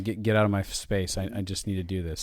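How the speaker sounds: irritated mutter. Retold or imitated. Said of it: "I'm like".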